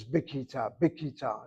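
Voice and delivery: bikita with a plain K in the middle; hard K sound. Shown as a demonstration. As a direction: say bikita with K, as everybody does. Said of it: "familiar with the K sound".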